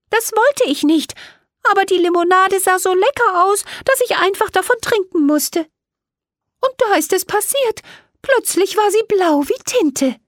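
a small fairy explaining her trouble and asking for help; little fairy Millie. A narrator's reading. Fairy Millie pleads with the two girls for help.

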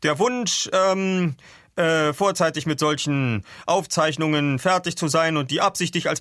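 hesitant formal captain. Acted. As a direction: deliver a wordy explanation, stumbling on ums.

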